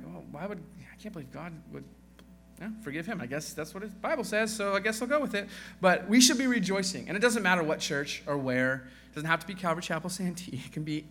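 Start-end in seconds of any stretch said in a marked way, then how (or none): 0.1-5.5 mock grumbling. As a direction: mimic a reluctant churchgoer giving in. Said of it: "We're a little bit grumbling".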